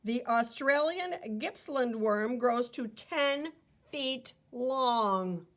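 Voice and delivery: ordinary delivery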